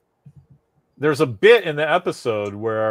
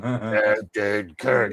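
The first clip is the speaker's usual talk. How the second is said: hint of a hissing lizard-man voice; mock Gorn growl, slow and drawn out; lightly voiced costumed lizard monster; 2 semitones lower, much slower than usual. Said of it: "a ridiculous guy wearing a scuba suit with a lizard helmet on it going".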